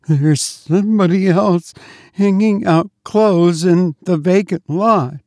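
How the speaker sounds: high, faint-with-fright maid; as if about to swoon from fear. A narrator's reading. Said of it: "spoke in a fainting voice".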